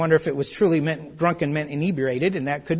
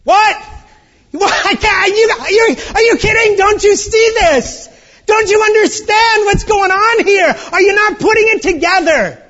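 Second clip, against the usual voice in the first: high, loud, incredulous shouting; stunned, scolding cry of are you kidding; exasperated outburst; 10 dB louder than usual. Acted. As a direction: hurl the questions at them in disbelief. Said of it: "And I like the first word".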